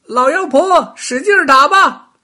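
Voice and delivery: high, loud call from the hilltop; loud shout. A narrator's reading. Rabbit shouts from the hilltop to the witch. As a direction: yell down the mountain. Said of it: "高声喊道".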